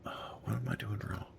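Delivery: fast, breathy whispered mutter. Real, not acted. Streamer whispers to himself, puzzled over a broken signal. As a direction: whisper it quickly to yourself.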